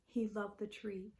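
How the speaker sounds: plain reading voice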